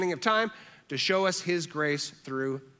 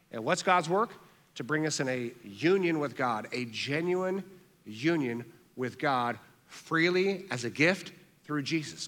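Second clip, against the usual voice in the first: usual speaking voice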